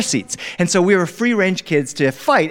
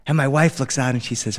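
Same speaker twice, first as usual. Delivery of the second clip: ordinary delivery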